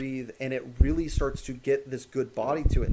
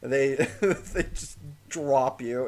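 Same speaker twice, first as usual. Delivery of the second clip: normal talking voice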